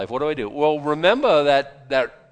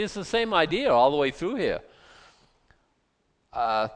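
normal talking voice

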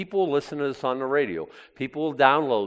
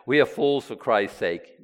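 ordinary talk

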